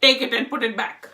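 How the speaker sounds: put-on loud, Indian-accented grandmother ordering; scolding grandmother with Indian accent. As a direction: fire off irritated commands in an Indian accent. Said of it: "I'll do the Indian accent".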